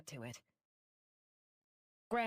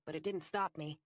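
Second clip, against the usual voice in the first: ordinary delivery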